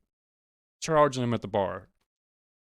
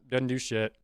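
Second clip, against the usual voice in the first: ordinary talk, nothing marked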